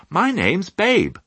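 high, slow, cheery introduction; jolly piglet. A narrator's reading. Piglet introducing himself to the sheep, jolly. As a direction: introduce yourself brightly as a little pig. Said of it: "he said in a jolly voice".

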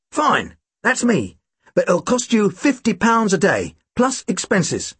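pompous little fee announcement; Tim's voice. Acted.

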